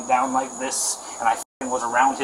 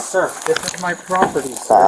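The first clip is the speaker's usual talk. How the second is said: louder and higher, urgent real plea; desperate pleading; 4 semitones higher, 7 dB louder than usual. Real, not acted. Homeowner pleading with officer during arrest, loud and desperate. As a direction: insist to the officer, pleading hard.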